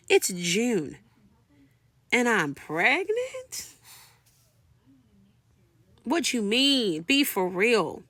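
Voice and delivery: high, drawn-out disbelief with a questioning swoop; incredulous. Retold or imitated. Woman with a cruise booked learns she's pregnant, incredulous. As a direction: stretch the key words in open protest.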